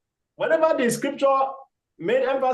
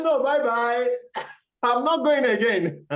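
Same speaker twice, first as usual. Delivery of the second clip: teasing monkey; 4 semitones higher than usual. Acted. Monkey mocks crocodile from tree.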